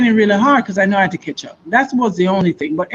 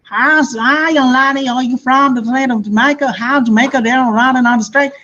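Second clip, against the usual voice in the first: put-on Southern accent; 4 semitones higher than usual. Oklahoma local asking the Jamaican girl about home, Southern twang. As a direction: mimic a friendly Southern local. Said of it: "what you hear when you go over there".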